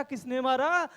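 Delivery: usual speaking voice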